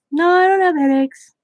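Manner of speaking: high-pitched imitation of a child; imitated little girl. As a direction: pipe up like an insistent little girl. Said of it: "She goes".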